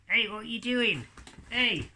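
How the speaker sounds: indignant little puppet being woken; puppet Hadley, startled. Acted.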